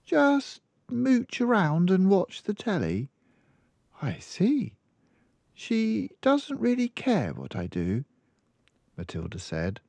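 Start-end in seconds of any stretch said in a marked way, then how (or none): 0.0-4.8 put-on much higher little girl voice; child explaining her mother's habits; small girl. Girl answers. 5.5-8.0 put-on little girl voice, mildly sad; a touch of sadness in the child; child admitting her parents don't care; a little sadly. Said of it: "a little sadly".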